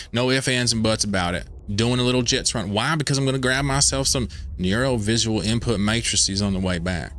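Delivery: lightly hammed-up meme Southern drawl, y'all and daggum; a hint of a cranky Southern trucker in Southern drawl. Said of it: "I have a halfway decent meme version".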